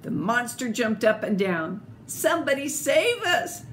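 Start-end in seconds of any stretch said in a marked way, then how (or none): 2.1-3.6 higher frightened cry for help; mock shriek. A narrator's reading. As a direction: call out for rescue. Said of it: "shrieked the dogs".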